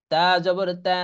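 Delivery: ordinary talk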